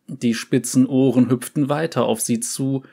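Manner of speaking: ordinary delivery, nothing marked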